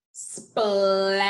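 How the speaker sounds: SPLASH stretched out, every sound held; slow-motion splash for sounding out; said slowly. Shown as a demonstration. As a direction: draw out splash through sp, l, a, sh. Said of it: "I'm gonna say it slowly".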